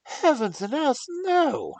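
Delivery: very loud, very high outraged cry; stormy outcry. Acted. Young lord cries out against the doctor's plan. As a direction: explode in horror. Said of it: "cried Arthur in a storm of passion".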